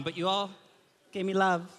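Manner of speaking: usual speaking voice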